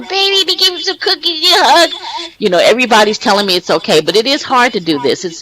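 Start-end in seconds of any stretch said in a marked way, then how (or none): 0.0-1.9 much higher, doting grandmother voice; high sweet granny reassuring her nervous grandkid; grandma's voice. Retold or imitated. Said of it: "My grandma's going".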